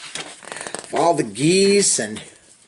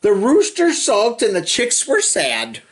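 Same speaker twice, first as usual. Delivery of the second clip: lively sing-song storybook reading; reading to children. Acted. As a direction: read the rhyme to little kids with bounce. Reading picture book to children.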